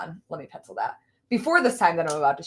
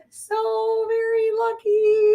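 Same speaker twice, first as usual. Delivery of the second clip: mock envious; 14 semitones higher, slower than usual. Mock echo, high and slow.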